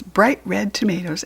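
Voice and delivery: usual speaking voice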